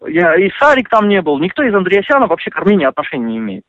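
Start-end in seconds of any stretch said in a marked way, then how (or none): none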